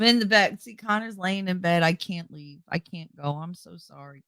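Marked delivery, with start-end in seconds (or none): 1.8-4.2 fake apologetic.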